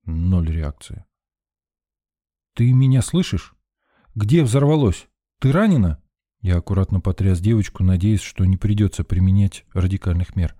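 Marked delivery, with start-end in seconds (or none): 2.5-6.0 raised, higher urgent questions; urgent hail, pitched up; calling out. A narrator's reading. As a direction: call out to someone in shock. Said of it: "окликнул".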